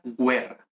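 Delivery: "guerra" said GWE-rra; "guerra" Latin-style, opening with gw; Latin sounded U. Shown as a demonstration. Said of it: "en latín esto sería guerra".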